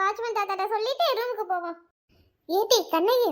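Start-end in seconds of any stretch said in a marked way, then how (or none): none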